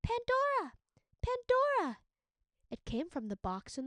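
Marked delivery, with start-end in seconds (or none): 0.0-2.0 much higher, louder tiny calling voice; tiny kitten-like voice. A narrator's reading. Creature in the box calling Pandora, tiny and high. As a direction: cry out like a trapped baby kitten. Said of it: "She heard it again".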